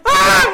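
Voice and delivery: very high, loud scream; hammed-up shriek of panic; imitated scream. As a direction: scream like a terrified friend. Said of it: "And he's going".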